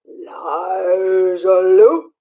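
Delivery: slow, low ghostly moan; moaning swamp haunt. Acted. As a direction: drag the name out like a haunt calling. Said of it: "that swamp haunt moaned".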